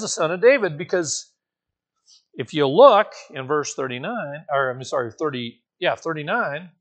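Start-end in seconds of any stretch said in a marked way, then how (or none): none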